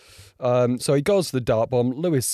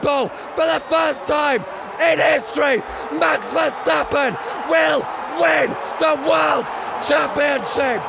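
excited live commentary; 14 semitones higher than usual. Real, not acted. Vlogger calling the title-deciding lap live, screaming excitement. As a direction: call the final lap like a frantic commentator.

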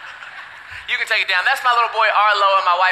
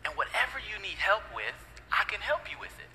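much lower, much softer, gentle fatherly talk; lower, softer tender dad voice; 4 semitones lower, 10 dB quieter than usual. Retold or imitated. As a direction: soothe the baby son quietly. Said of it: "I say".

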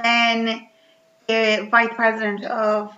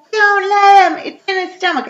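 high, loud alarmed cry; frantic warning; 10 semitones higher, 6 dB louder than usual. Retold or imitated. Friends voiced in the retelling, crying out high and alarmed. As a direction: yell it as a panicked warning. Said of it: "And they're like".

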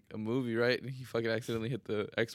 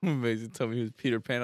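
normal talking voice

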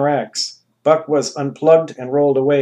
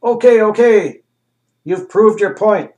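higher, louder desperate yell; yelled plea; 8 semitones higher, 8 dB louder than usual. Acted. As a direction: shout it out to make the cramps stop. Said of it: "the same official yelled".

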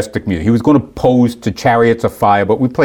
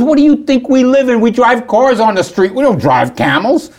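higher, louder mock indignation; lightly voiced offended bodybuilder scoffing; indignant Egyptian bodybuilder; 12 semitones higher, 3 dB louder than usual. Egyptian bodybuilder scoffing at the camel idea, higher mock indignation. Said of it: "He goes".

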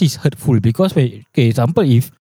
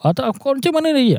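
imitating exasperated father; 13 semitones higher, 4 dB quieter, faster than usual. Father reacting, voiced.

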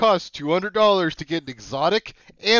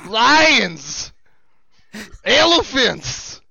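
put-on a lighter Pennsylvania accent for the zoo pitch; 6 semitones higher, slower than usual. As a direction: slip back into a Pennsylvania accent, pitched a bit higher at the end. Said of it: "The Pennsylvania accent there".

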